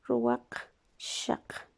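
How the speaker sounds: Ruwakshak stretched out very slowly and breathed, almost voiceless; slow breathy dictation. Shown as a demonstration. Teacher to class while writing, Ruwakshak breathed out very slowly. Teacher writes the new word.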